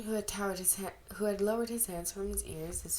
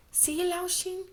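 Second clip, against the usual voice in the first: put-on high girl voice; 11 semitones higher, 3 dB louder, slower than usual. A narrator's reading.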